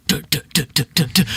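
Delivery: short rhythmic thumps; pounding heartbeat imitation. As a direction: imitate a racing heart beat by beat. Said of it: "literally pumping like I'm being fucking chased".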